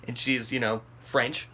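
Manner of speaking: ordinary delivery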